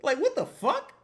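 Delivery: hammed-up fan asking if he hit the lottery; mock amazed fan. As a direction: play a stunned fan who can't believe it. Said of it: "every day I'll be like".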